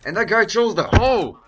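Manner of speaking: high, loud indignant yell; screaming. Acted. Storyteller protests his friend breaking the bro code, screaming indignantly. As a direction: scream it like a broken sacred rule. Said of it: "you're screaming".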